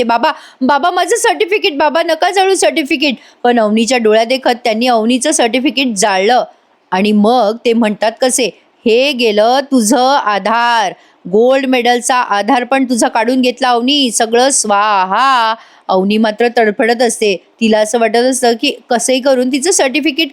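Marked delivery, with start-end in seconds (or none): 0.0-3.1 high, desperate cry to stop him; frantic pleading. Retold or imitated. Daughter begs father not to burn her certificate, frantic and high. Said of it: "Avni वेडीपिशी होते आणि म्हणते". 8.9-15.5 slow gloating. Retold or imitated. Father mocks burning certificate.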